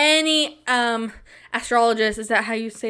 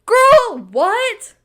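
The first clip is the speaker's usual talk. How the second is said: shocked disbelief; 11 semitones higher, 9 dB louder than usual. Real, not acted. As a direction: squeal it out in disbelief. Shocked reaction.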